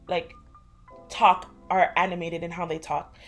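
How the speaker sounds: usual speaking voice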